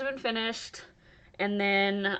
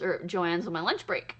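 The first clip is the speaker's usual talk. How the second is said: usual speaking voice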